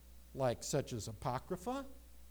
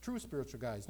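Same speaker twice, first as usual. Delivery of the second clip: normal talking voice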